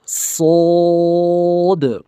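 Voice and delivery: सोद held long and thick; heavy letter. Shown as a demonstration. Teacher models a long, heavy सोद. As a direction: draw out सोद heavy from the back. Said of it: "सौद को मोटा पढ़ना है".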